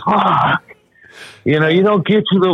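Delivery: high, fast exasperated cursing; panicking gamer. Retold or imitated. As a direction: spit it out in disbelief. Cursing while reliving the play.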